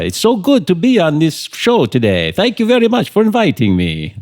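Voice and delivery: high, loud put-on Italian accent; fake Italian accent. Shown as a demonstration. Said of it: "my best stab at a fake Italian accent".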